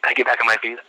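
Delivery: plain reading voice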